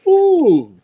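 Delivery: high, loud delighted ooh; a real burst of excitement; excited ooh. Real, not acted. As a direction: squeal it out with delight. Greeting stream viewers excitedly.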